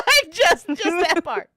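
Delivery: high, breaking into laughter; talking through real laughter; laughing. Real, not acted. Asking for a screen cap of the death while laughing.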